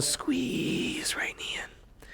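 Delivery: slow breathy whispered cue; whispering. Shown as a demonstration. Whispered cue during pose.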